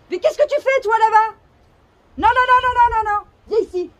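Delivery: loud high scolding of a dog; scolding shout. Real, not acted. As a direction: yell at the dog to stop. Scolding the dog far away.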